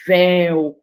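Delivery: "véu" with a strong open é; "véu" said firmly, é stressed; strong stressed vowel. Shown as a demonstration. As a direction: lean hard on the é of "véu". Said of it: "tônico, tão forte".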